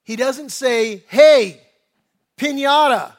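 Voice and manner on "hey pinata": loud, higher shout of a hail; mock calling out. What Bartimaeus doesn't say to Jesus, mock holler. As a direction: holler it out, hammed-up.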